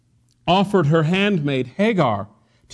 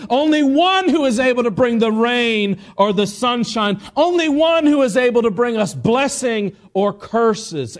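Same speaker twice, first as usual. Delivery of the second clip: full-force preaching; 7 semitones higher, 4 dB louder than usual. Real, not acted. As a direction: preach it hard, rallying the congregation.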